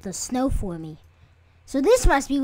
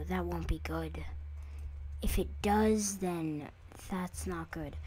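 whisper; 5 semitones lower, 12 dB quieter than usual. Real, not acted. Muttering worry to viewers.

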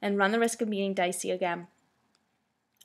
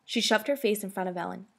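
ordinary delivery

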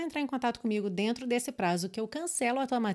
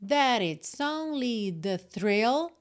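slow demo reading; 3 dB louder, much slower than usual. Shown as a demonstration. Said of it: "Então, olha".

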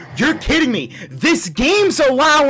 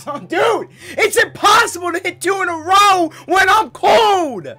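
raging gamer; 9 semitones higher than usual. Real, not acted. Shouting complaint.

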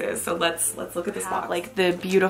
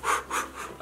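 soft, airy puffs out; steadying breath; 11 dB quieter than usual. Real, not acted. Vlogger steadying herself before opening the box, breathing out. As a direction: blow out the nerves quietly.

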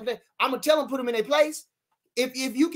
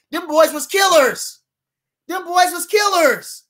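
loud, high, slow hype; slow it down and hammer each word, louder each time; emphatic hype; 8 semitones higher, 8 dB louder, slower than usual. Real, not acted. Hyping the fighters Ward beat.

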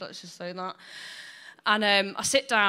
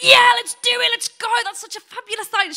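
much higher, louder camp squeal; 14 semitones higher, 8 dB louder, faster than usual. Retold or imitated. Mum jumping at the film idea, camp squeal. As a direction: gush like an excited camp friend. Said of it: "She got really camp".

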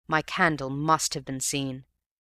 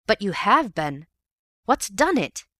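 alarmed; 6 semitones higher, 5 dB louder than usual. A narrator's reading. Ewbank questions the wounded man.

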